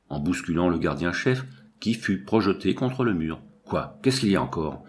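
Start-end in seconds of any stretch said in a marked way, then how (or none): 3.6-4.8 lightly voiced gruff, low-pitched chief; a touch of hoarseness, pitched down; hoarse big voice. A narrator's reading. Head guard reacts to the shoving, in a low hoarse voice. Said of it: "s'écria une grosse voix enrouée".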